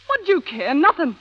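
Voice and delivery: ordinary talk, nothing marked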